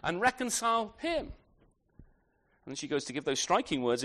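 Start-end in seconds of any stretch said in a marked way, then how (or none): 0.0-1.3 lightly voiced protest, pitched up; pleading woman's challenge. Widow challenging the king over his own son, lightly acted higher-pitched protest. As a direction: put her case to the king, higher in pitch. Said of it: "So she's saying".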